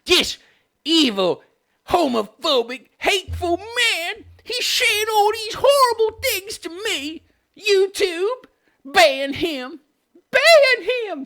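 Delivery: high, tearful mock wail; put-on crybaby meltdown; mock flipping out. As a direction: sob out accusations in a hammed-up whine. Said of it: "So he's flipping out".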